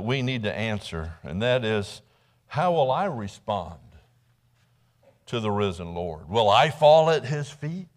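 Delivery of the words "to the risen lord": normal talking voice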